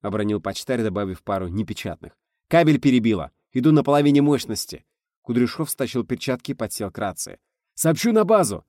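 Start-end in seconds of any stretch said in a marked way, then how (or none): 2.5-4.8 loud, raised emergency report; urgent report. A narrator's reading. Driver reporting damage in emergency. 7.7-8.6 loud, high announcement; urgent shout. A narrator's reading. Shouting during meteor emergency.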